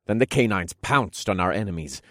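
plain reading voice

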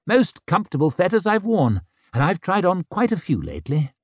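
breezy joking. A narrator's reading. Orso joking to his jailer about the fetters, breezy.

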